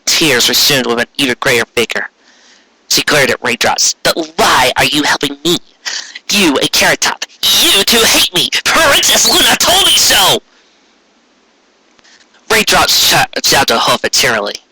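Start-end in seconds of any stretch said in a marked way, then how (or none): 4.0-10.4 hurt outburst. Acted. Trixie demanding why her enemies help, high hurt outburst. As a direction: let the hurt boil over.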